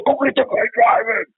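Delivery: high, loud mock yell of a partner; training officer yelling at the rookie, high mock shout; barked order. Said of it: "he goes".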